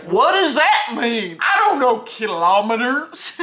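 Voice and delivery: loud, slow, drawn-out bafflement; mock confused American. Vlogger imitating American followers baffled by kilometers, mock confused. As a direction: ham up a clueless follower, stretching the words. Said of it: "Every American follower was like".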